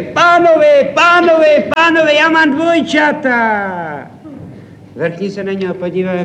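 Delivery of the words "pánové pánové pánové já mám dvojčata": much higher, loud calling out; overjoyed new father bursting in, shouting; excited shout from afar. Acted. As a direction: yell across an empty restaurant. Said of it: "už z dálky vykřikuje".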